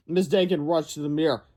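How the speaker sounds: ordinary delivery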